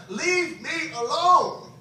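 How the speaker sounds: loud, high, drawn-out acted yell; defiant retold cry. Preacher re-enacts crying out to Jesus on his knees, loud and defiant. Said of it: "I was on my knees, crying, threw my".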